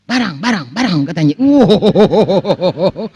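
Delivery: wailing, drawn-out "wow" in mock fear; hammed-up retold panic, words stretched out; mock frightened cry. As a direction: imitate a scared traveller, slow and quavering. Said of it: "dia katakan".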